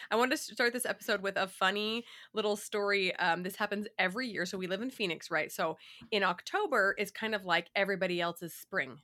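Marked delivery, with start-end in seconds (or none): none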